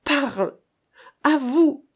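mother half-choked with emotion. A narrator's reading. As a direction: barely hold the voice together. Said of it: "d'une voix à peine distincte d'émotion".

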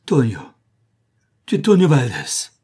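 loud narrated cry of recognition; heart-rending cry. A narrator's reading. Woman recognises the lost child Tonio, heart-rending cry. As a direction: cry the name out in anguish. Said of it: "pousse un cri déchirant".

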